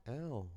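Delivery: sharp, high, loud ow; yelp of pain. Acted. Little Izuku yelping at the knife prick, high and loud.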